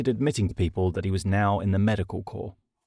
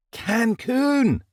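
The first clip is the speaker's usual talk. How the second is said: high, thrilled cry; excited exclamation; 3 dB louder than usual. A narrator's reading. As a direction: shake him with the word. Jackson shakes him.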